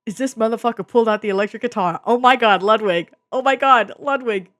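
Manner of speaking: loud, high excited shouting; hyped yelling. Real, not acted. Fan raving about the guitar solo, loud hyped shouting. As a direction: yell it out like the solo just hit.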